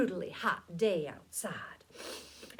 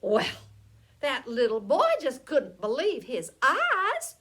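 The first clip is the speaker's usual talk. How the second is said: ordinary delivery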